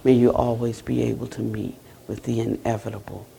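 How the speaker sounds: low, hushed dharma-talk close; closing blessing. Real, not acted. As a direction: offer a soft, low blessing with long pauses.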